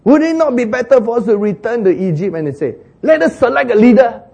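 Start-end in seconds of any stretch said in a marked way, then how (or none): 0.0-2.4 whining complainers. Acted. Israelites wanting Egypt. 3.0-4.2 high, loud rallying cry; rally the crowd to turn back; rebellious call. Acted.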